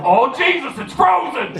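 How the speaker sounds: high-pitched comic alarm; hammed-up panicked yelp; mock panic. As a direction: cry out in exaggerated horror. Retold alarm at frozen eye.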